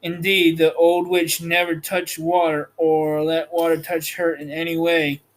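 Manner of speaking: plain reading voice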